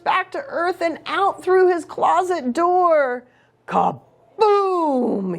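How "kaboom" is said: hollered. A narrator's reading. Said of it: "he hollered".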